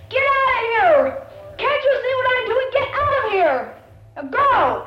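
high, very loud screamed order; furious yell. Acted. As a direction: yell at the kid as an enraged mother, full voice. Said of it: "And she yells".

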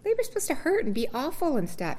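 very high, insistent mock protest; naive denial. Telling the nurses she isn't in labor, very high and insistent. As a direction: squeak out your disbelief. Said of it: "And I said".

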